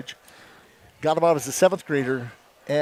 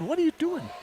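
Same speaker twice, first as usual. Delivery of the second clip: baffled coach; 10 semitones higher, 6 dB quieter than usual. Retold or imitated. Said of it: "I said".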